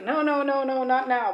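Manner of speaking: normal talking voice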